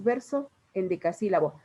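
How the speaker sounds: VERSO, pausa, EN DECASÍLABO, sin unir las vocales; palabras separadas. Shown as a demonstration. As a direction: separa VERSO de EN DECASÍLABO con un corte claro. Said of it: "cuando hablamos no decimos". (dicho con las palabras separadas)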